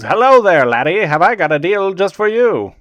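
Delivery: put-on a deliberately terrible Scottish accent, pitching a deal; bad Scottish accent. Scottish salesman hooking the player on a deal, bad Scottish accent. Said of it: "my Scottish accent is terrible".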